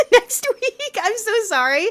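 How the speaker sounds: high giggling through the words; laughing apology. Real, not acted. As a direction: laugh it out, sorry but amused. Laughing at co-working plug.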